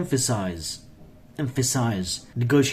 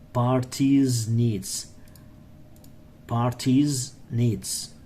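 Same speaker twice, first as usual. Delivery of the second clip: slow drill; much slower than usual. Shown as a demonstration. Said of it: "teacher drills slowly".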